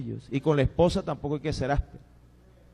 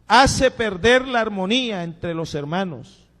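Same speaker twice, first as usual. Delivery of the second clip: louder and higher, slow and emphatic; loud preaching; 4 semitones higher, 7 dB louder, slower than usual. Acted. Pastor warns brothers about discord, loud and emphatic. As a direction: proclaim it to the back row. Loud first take of the line.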